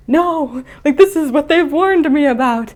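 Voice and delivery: high, loud cartoon alarm; mock panic. Young sea monster facing a diver, mock panic. Luca meets harpoon-carrying scuba diver.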